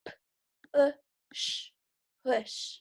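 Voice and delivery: each sound segmented, then blended into "push"; sounds tapped out. Shown as a demonstration. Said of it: "We're gonna tap out those sounds".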